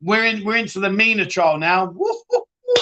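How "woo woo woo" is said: very high, gleeful woo woo; excited whooping. Real, not acted. Host cheering the soap storyline, high gleeful whoops. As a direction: whoop like a thrilled soap fan.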